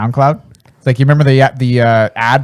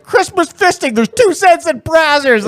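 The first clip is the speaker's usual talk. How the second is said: mock clip voice; 13 semitones higher, slower than usual. Mimicking a clip heard mid-podcast.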